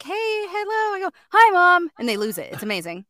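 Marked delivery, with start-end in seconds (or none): 0.0-1.0 greeting women. Retold or imitated. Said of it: "and they're like". 1.3-1.9 put-on high, louder boy greeting. Boy greeting his commercial mom, high boy voice. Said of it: "I've done commercials as him".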